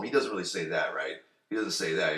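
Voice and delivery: ordinary talk, nothing marked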